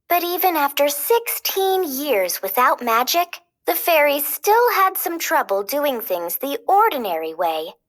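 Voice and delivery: plain reading voice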